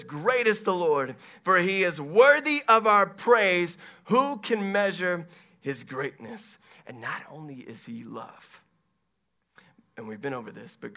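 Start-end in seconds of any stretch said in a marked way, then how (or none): 0.0-6.6 loud, raised declaration of praise; ringing pulpit proclamation, easing at the end; proclaiming scripture. Real, not acted. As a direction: read the psalm out boldly to the congregation. Proclaims a psalm.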